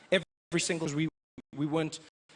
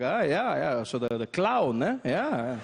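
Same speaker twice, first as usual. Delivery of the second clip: unimpressed German dad; slower than usual. Retold or imitated. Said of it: "He's like".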